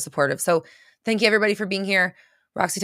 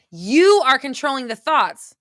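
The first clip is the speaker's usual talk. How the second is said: louder, higher, slow insistence; forceful urging; 6 semitones higher, 7 dB louder, slower than usual. Real, not acted. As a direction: drive the point home loud and slow. Forceful coaching.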